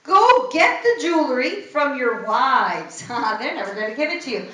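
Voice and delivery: ordinary talk, nothing marked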